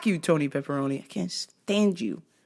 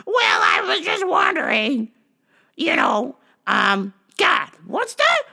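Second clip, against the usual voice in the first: high, shouting, slowing toward the end; high hammed-up old-lady threat; mock flustered old lady; 10 semitones higher, 3 dB louder, slower than usual. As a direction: bluster like the confused caller. Caller flustered, threatening to call.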